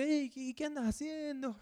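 high, breezy friendly call; casual greeting as Jesus. Retold or imitated. Jesus casually greets the Samaritan woman at the well, high and breezy. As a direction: toss it off lightly, like a friendly stranger.

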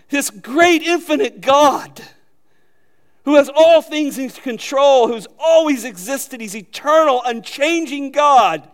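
high, loud proclamation with stretched key words; fervent preaching. Real, not acted. Preacher exalting the infinite God, loud and fervent. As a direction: raise the voice and pile up God's attributes, slowing on "God".

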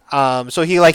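ordinary talk

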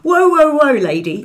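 high, loud mock alpha male; hammed-up bloke shutting a woman down; macho brush-off. Imagined macho guy brushing her off.

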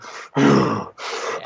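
heavy huffing, mimicked; imitated running breaths. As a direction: puff hard like someone charging at a run. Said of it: "hearing him just running and go".

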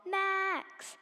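high desperate scream of a name. A narrator's reading. Grinch falling off the cliff, screaming for Max. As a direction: scream for your dog while falling. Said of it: "he screamed".

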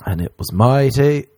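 ordinary talk

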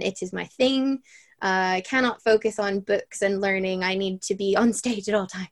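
ordinary talk, nothing marked

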